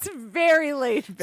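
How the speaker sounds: high, light prim librarian voice; lightly voiced librarian. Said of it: "talk in my librarian voice".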